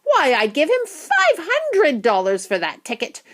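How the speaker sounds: high, indignant woman's voice; shouting. A narrator's reading. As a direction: outbid loudly and indignantly. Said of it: "shouted a woman".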